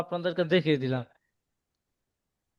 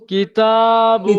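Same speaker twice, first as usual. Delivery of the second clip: slow loud demo; 4 semitones higher, 6 dB louder, much slower than usual. Shown as a demonstration. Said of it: "teacher models reading loudly".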